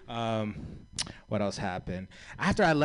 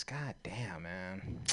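whispered; 4 semitones lower, 13 dB quieter, much slower than usual. Real, not acted.

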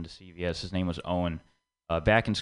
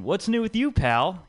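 cheery catch-up question; 8 semitones higher, 3 dB louder, slower than usual. Retold or imitated. Friend asking to catch up.

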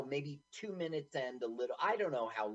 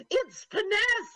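put-on high, loud old lady correcting; shrill put-on woman's voice; 6 dB louder, slower than usual. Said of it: "lady voice today".

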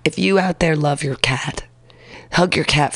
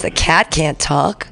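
normal talking voice

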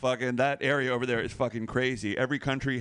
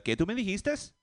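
higher, quick questioning mom; imitated Puerto Rican mom; 6 semitones higher than usual. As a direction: challenge like a mother, rising at the end. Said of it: "And she would say".

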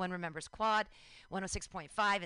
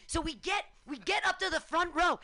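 plain reading voice

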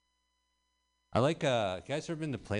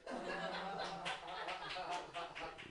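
giggle; 12 semitones higher, 9 dB quieter than usual. Real, not acted. Laughing at his own joke.

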